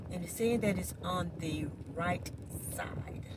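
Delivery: ordinary talk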